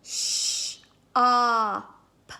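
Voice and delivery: segment "Sh, op" for the class; sounding out shop. Shown as a demonstration. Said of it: "Tell me the sounds in shop".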